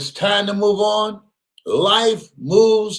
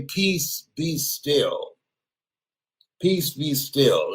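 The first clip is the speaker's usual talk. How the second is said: soft, slow "Peace, be still"; calm quiet; 4 semitones lower, 6 dB quieter, much slower than usual. Real, not acted. Telling himself to rise above things, soft and slow. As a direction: soothe yourself. Calming scripture phrase.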